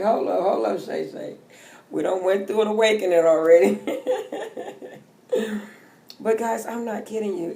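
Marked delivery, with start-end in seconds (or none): 0.0-3.8 a louder, laughing self-scolding; self-talk with a laugh in it; mock scolding self-talk. Quoting herself to herself. 3.8-5.0 airy laugh that cracks up high; giggling at herself; high breathy laugh. Real, not acted. Laughing at her own story.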